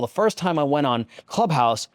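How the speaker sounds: usual speaking voice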